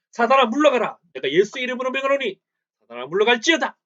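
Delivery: loud, high exorcising command; commanding rebuke. Acted. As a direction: command the devil to leave in Jesus' name. Man rebukes Satan in Jesus' name.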